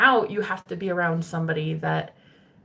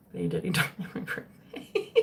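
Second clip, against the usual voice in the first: half-laughing; 6 dB quieter than usual. Real, not acted. Laughing confession about ex.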